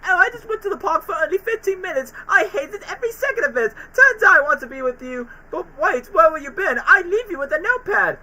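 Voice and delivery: very high cartoon voice; acted quote. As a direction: squeak it as an anxious cartoon pal. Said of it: "it's like".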